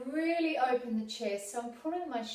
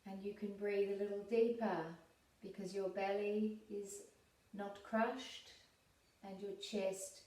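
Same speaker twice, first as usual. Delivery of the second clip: calm, unhurried meditation voice with long pauses; guided meditation; 4 dB quieter than usual. Real, not acted. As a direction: guide them gently inward, leaving space to breathe.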